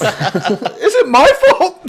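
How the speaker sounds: high, slow, laughing mock child. Joking about a divorce, slow laughing child voice. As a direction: play the guilty kid, laughing.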